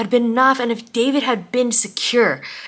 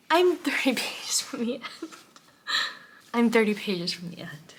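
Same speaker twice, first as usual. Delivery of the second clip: hushed, breaking into a whisper; soft, overwhelmed, holding back tears; trying not to cry; 8 dB quieter than usual. Real, not acted. Reader thirty pages from the end, quietly overwhelmed. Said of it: "I am trying not to cry".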